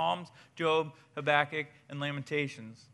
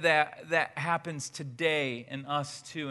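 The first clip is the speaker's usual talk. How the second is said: ordinary talk, nothing marked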